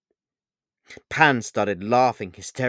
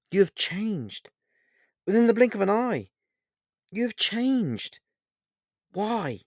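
desperate; 10 semitones higher than usual. A narrator's reading. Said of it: "he desperately attempted to bring order".

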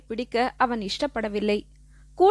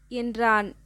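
ordinary delivery

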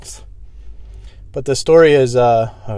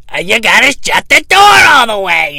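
high, loud, slow mock yell at girlfriend; hammed-up angry boyfriend bellowing; yelling across the laundromat; 10 semitones higher, 10 dB louder, slower than usual. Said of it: "fucking screaming at his girlfriend".

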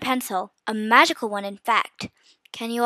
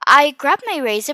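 ordinary delivery, nothing marked

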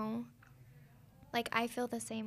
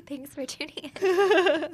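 laughing through a slow, loud sign-off; 6 semitones higher, 11 dB louder, slower than usual. Real, not acted. Cohost laughing as she signs off the podcast, laughing. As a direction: laugh out the goodbye.

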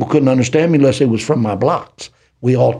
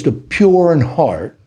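very slow, drawn-out pulpit reading; preaching scripture; 4 semitones higher, much slower than usual. Acted. Preacher quoting the Bible to his listener, slow and solemn. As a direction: lean into the verse like a sermon.

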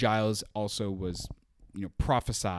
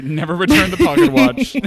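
laughing; 12 semitones higher, 10 dB louder, faster than usual. Real, not acted. Joking about Buffy keeping watch.

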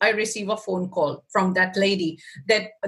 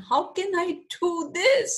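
panicked disbelief; 9 semitones higher, much faster than usual. Retold or imitated. Retelling her panicked reply.

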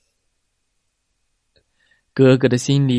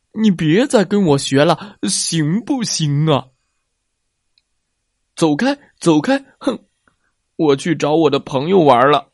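shouting big brother; 3 semitones higher than usual. A narrator's reading. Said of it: "冲着弟弟大声地嚷嚷着".

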